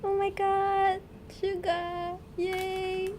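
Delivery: high-pitched fan squeal; thrilled, drawn-out high cheer; hyped squeal. Real, not acted. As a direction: squeal with delight without shouting. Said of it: "like, so hyped right now".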